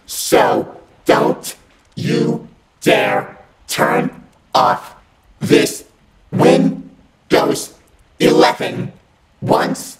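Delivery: ordinary delivery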